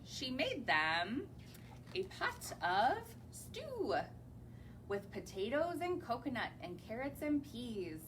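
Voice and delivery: gentle, lilting storybook rhythm; reading to children. Acted. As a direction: read the rhyme to a little audience. Reads rhyming picture book aloud.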